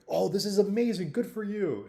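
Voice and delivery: high, gushing mock praise; excited villagers. Villagers cheer the farmer's luck.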